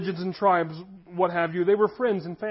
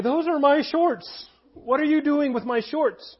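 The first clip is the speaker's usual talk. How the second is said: higher, slow indignant "those are my shorts"; annoyed teenage complaint; 7 semitones higher than usual. Retold or imitated. Teen confronting older brother over borrowed shorts, indignant. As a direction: protest like a wronged younger brother. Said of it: "And I would say".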